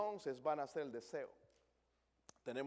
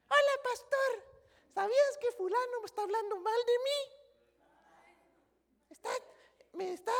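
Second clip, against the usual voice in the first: put-on very high, tattling little-child voice; whiny childish believer. Pastor mocks immature churchgoer tattling, very high childish whine. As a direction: squeak it like a small child telling on someone. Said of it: "los enanos de la iglesia".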